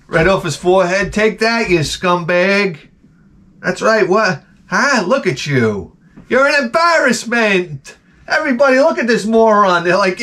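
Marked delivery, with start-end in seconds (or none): 1.1-9.8 high-pitched heckler taunts; hammed-up scornful heckling; mock jeering. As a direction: jeer at him for the whole town to hear. Acted jeering at man in stocks.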